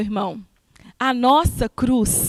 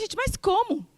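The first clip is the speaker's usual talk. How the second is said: shocked disbelief; 8 semitones higher than usual. Retold or imitated. Shocked young convert replies.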